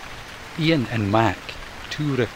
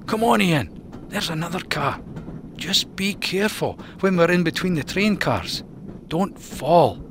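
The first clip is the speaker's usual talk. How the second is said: much higher raccoon voice, cautioning; careful Mac; 7 semitones higher than usual. Acted. Mac to Ian, higher careful warning. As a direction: lead him across the gap.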